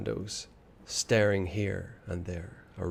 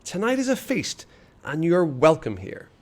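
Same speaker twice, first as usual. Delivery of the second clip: high, lilting welcome; boy's invitation; 6 semitones higher than usual. A narrator's reading. Phantom boy inviting Ivan to the feast, high and lilting. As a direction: lure him in with a friendly lilt. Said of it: "he said".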